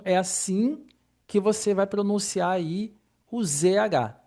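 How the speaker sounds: ordinary delivery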